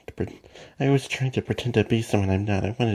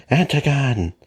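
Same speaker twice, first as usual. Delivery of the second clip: sharp shout; 4 semitones higher, 6 dB louder, slower than usual. Acted. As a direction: yell the name loud and a bit higher. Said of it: "Calm down".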